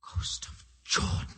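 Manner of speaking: slow breathless whisper of wonder; hushed oath of wonder at the stars; awed whisper. Acted. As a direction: breathe it out in awe. Awestruck at the stars.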